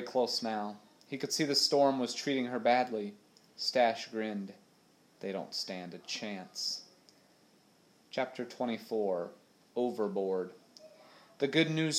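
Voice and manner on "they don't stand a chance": low gloat. A narrator's reading. Stash grins, confident gloating.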